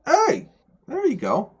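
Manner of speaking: sudden high, loud "Hey!"; light up and call out hello; excited greeting. Real, not acted. Streamer greets a friend joining, excited loud hello.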